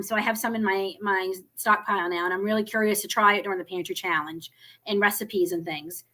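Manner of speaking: ordinary talk, nothing marked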